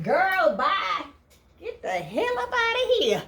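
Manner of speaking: high, sassy dismissal; sassy kick out. Retold or imitated. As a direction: throw her out with attitude. Quoting Hannah throwing her out.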